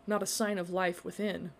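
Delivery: ordinary delivery, nothing marked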